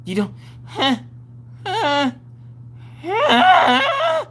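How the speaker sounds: huffs rising to a high shout; exasperated shout. Retold or imitated. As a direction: build from huff to yell. Mom losing it, shout.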